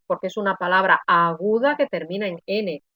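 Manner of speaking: normal talking voice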